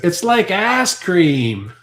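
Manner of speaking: loud, drawn-out Southern "ice cream" as "ass cream"; Southern US accent. Acted. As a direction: stretch it slowly in a mock Southern accent. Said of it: "the accent when they say ice cream from the South".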